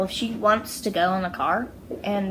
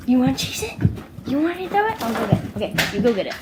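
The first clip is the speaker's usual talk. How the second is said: higher, louder fetch chatter; excited dog play; 4 semitones higher, 6 dB louder, much faster than usual. Real, not acted.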